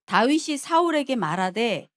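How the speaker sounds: loud, slow declaimed reading; scripture reading. Acted. Preacher leading the congregation through Samuel, loud scripture reading. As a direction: proclaim the verse with measured force. Reads the Bible passage aloud.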